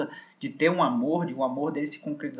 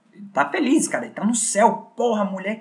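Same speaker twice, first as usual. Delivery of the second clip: much higher, louder burst of joy; excited; 7 semitones higher, 4 dB louder than usual. Real, not acted. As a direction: blurt it out overjoyed. Thrilled retelling of the kiss.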